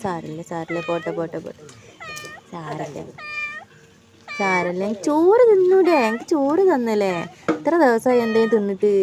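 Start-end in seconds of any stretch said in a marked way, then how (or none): 0.0-4.8 quietly calm the frightened cat; soothing hush. Real, not acted. 4.9-7.1 voicing the cat. Speaking as the cat.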